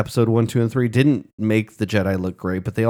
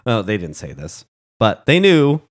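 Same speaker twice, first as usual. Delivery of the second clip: ordinary talk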